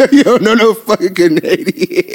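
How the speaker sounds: high, slow, through laughter; drawn-out high-pitched laughing jab; laughing disbelief. Real, not acted. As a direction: laugh out the teasing words. Laughs teasing friend about Canadians.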